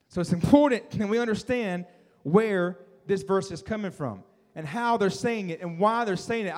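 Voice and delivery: ordinary talk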